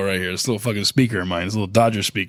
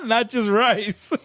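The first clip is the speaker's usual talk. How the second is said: laughing; 9 semitones higher, much slower than usual. Real, not acted. Repeating joke while laughing.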